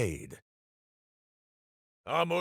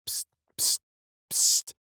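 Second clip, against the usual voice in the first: whispered psst. Acted. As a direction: hiss for attention without being heard. Calling quietly for attention.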